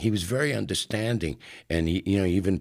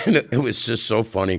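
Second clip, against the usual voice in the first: ordinary delivery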